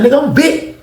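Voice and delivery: puffed-up high boast; imitating a tough guy; cocky swagger. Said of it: "He said that shit like he was him".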